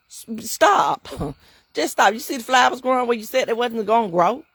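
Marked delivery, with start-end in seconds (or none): none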